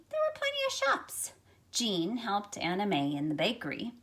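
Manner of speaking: gentle storybook read-aloud for a class; reading to children. Acted. Teacher reading a picture book to her class, storytelling voice. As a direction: tell the story to young listeners, unhurried.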